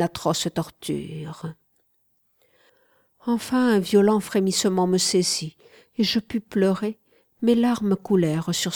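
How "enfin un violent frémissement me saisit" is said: ordinary delivery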